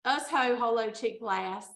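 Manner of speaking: ordinary talk, nothing marked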